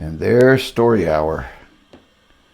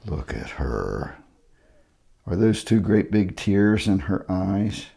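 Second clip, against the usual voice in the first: ordinary delivery, nothing marked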